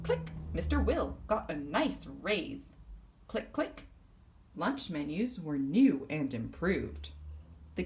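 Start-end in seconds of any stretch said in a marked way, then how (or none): 3.3-3.9 high, light clicks of a button; mock clicking noise. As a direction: tap out each click like a mouse. Clicking the office controls.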